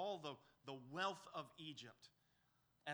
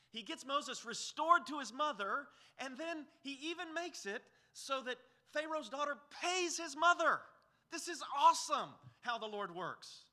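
higher, louder, swelling exultant preaching; preaching at full force; 9 semitones higher, 8 dB louder than usual. Acted. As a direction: build it up like a preacher in full flight. Preacher exults over God's work.

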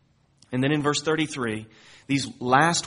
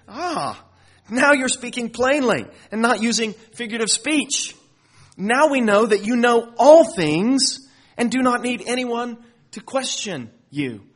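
high, loud, excited voicing of the disciples; eager disciples; 8 semitones higher, 6 dB louder than usual. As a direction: burst out like thrilled followers who finally get it. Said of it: "Look at what they say".

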